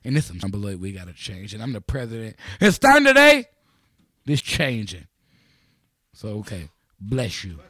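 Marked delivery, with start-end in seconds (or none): 0.1-5.1 low, gruff mock president address with a loud burst; mock president speech. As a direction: play a fed-up president of Beloit. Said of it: "I want you to say".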